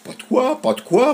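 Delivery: very high, airy gull voice; breezy gull. A narrator's reading. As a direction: toss off the thanks like a seagull. Gull answers lightly. (mouette désinvolte, voix très aiguë)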